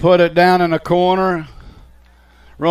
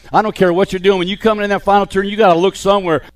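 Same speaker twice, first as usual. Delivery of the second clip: ordinary talk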